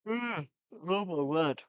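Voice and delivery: slow, stretched words through a real yawn. Real, not acted. Vlogger yawning to viewers mid-match review, slow yawned words. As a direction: let the yawn pull the words out. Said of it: "Guys, I keep yawning".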